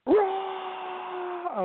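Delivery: shouted very high. Acted. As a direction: yelp "Raw!" in a piercing cry. Joking about his raw throat.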